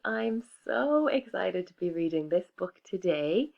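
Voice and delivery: bright hello to the class; upbeat welcome to little kids; cheery teacher greeting. Acted. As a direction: greet the children like a storyteller. Teacher greeting class before reading.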